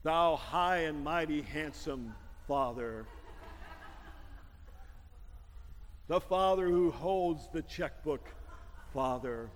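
slow, pompous mock pleading with a low put-on voice; mock flattering daughter. Pastor mimics daughters sweet-talking their father, slow and hammed-up. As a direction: ham it up like a wheedling child.

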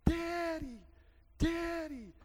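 put-on high, loud child calling daddy; three-year-old daughter shouting for her dad; little girl crying out. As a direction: call out like a scared toddler. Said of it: "she's crying".

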